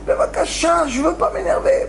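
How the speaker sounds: urgent prayer, pitched high; pleading. Retold or imitated. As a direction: beg him not to let you get angry. Student's retold plea to God.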